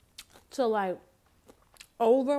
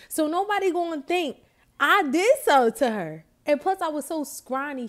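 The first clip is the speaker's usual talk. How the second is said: higher and louder, animated; excited; 5 semitones higher, 5 dB louder than usual. Real, not acted. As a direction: build up with energy. Excited storytelling peak.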